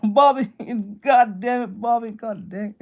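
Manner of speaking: lightly voiced exasperated Texan dad; a hint of a fed-up small-town father; Hank Hill. Streamer jokingly voicing a cartoon dad, mild exasperation. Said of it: "Hank Hill catchphrase".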